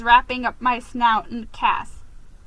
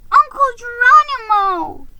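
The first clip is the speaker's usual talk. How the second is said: put-on high, loud worried young squeak; high, loud worried squeak of a little nephew; 13 semitones higher, 9 dB louder than usual. A narrator's reading. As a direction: call out to your uncle in alarm. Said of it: "he squeaked, worried".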